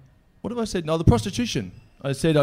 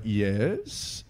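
hushed, drawn-out imitation of a caller; softer, put-on horror-actor yes; Vincent Price; 3 semitones lower, 7 dB quieter than usual. Answering a prank call from a comedian, hushed Vincent Price impression. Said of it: "pretty good Vincent Price impersonation".

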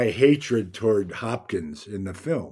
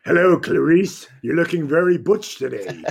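higher, louder mock-menacing movie villain greeting; playful put-on villain voice, pitched up; Hopkins impression; 6 semitones higher, 4 dB louder than usual. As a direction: imitate a co-star teasing in his villain voice. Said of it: "And he finally goes".

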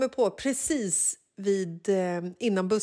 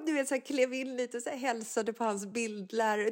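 usual speaking voice

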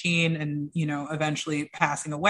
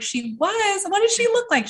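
surprised disbelief; 13 semitones higher than usual. Retold or imitated. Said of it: "And I was like".

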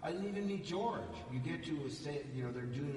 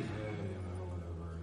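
low, offhand shrug of a reply; cool indifference; 9 semitones lower than usual. Retold or imitated. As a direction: dismiss it like the cool guy. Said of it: "And he's".

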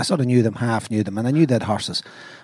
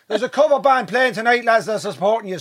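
barman voice; 7 semitones higher than usual. Retold or imitated. Barman quoted telling band.

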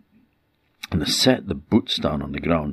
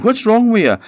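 alarmed shout; 8 dB louder than usual. Acted. Husband rushes out to screaming wife.

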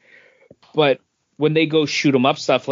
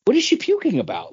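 high, quick incredulous question; mock bewildered squeak; baffled disbelief; 12 semitones higher, faster than usual. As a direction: ask it like it makes no sense at all. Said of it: "I was like".

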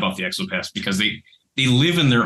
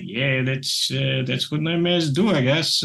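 higher, offhand shrug of a voice; 4 semitones higher than usual. Retold or imitated. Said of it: "[name] kinda like".

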